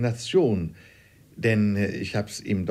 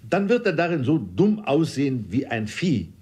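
higher, louder protest; father insisting, voice raised; father calls louder; 5 semitones higher, 4 dB louder than usual. A narrator's reading. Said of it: "rief mein Vater in einem lauteren Tone".

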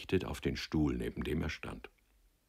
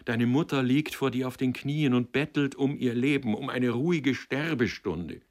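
high-pitched, imploring lament; understated tearful pleading; sobbing plea; 7 semitones higher, 4 dB louder than usual. A narrator's reading. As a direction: beg him, voice raised in anguish. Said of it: "schluchzte sie".

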